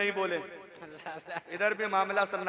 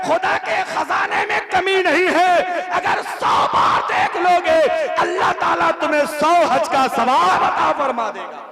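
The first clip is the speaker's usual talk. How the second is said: loud, high fervent preaching; preaching at full force; 8 semitones higher, 12 dB louder than usual. Acted. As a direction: thunder it out to the crowd. (जोशीली तकरीर, ऊँची आवाज़ में)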